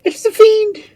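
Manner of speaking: very high, loud shriek; frightened high-pitched outcry; terrified scream. A narrator's reading. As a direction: shriek in horror at a fiend. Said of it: "screamed Rosabella".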